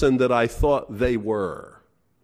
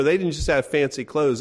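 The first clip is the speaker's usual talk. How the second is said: usual speaking voice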